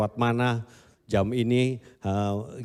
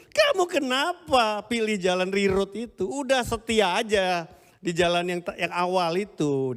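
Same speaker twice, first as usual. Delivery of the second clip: scolding; 8 semitones higher than usual. Retold or imitated. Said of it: "saya omelin dia".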